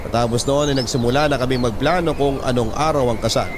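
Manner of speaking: usual speaking voice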